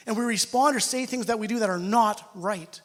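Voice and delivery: ordinary talk, nothing marked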